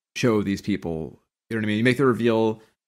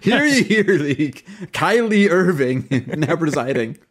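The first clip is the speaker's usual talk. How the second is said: slow, high proclamation broken by laughter; mock court crier; 5 semitones higher, 3 dB louder, slower than usual. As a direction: announce the judge like a hammy town crier. Joking as court crier announcing judge.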